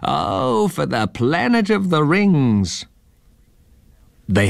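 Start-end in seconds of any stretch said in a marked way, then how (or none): 0.0-2.9 high, slow squawk to the sky; a slow, high bird-like squawk; squawking eagle-man. Acted. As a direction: cry it out like a lost space bird.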